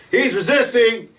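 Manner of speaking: high, loud mock cop shout; mock officer yell. Police officer shouting at the guard at gunpoint, mock yell. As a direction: yell the accusation like a trigger-happy cop.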